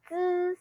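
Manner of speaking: "z" held long and high as a consonant; sustained z sound. Shown as a demonstration. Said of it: "use that mouth shape to say".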